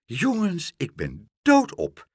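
mildly merry exclamation; high start, loud emphatic finish; laughing call. A narrator's reading. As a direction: call out in happy exhaustion. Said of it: "lachend zitten en riep".

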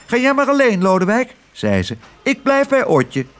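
hoge, vinnige muizenstem. Acted. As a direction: een koppig muisje. Suzie de muis spreekt. (hoge muizenstem van Suzie)

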